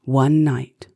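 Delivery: usual speaking voice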